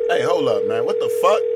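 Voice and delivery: loud outraged yell; angry shout. Acted. Insulted caller shouting.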